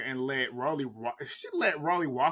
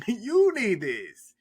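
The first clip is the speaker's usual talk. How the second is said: hammed-up mocking; 6 semitones higher, 5 dB louder, much slower than usual. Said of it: "She said it to Suki too".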